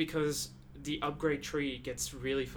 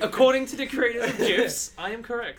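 mock pompous; 9 semitones higher, 12 dB louder than usual. Mock-pompous gif argument.

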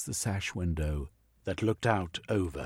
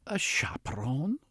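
incredulous; 8 semitones higher than usual. Acted. Incredulous lady.

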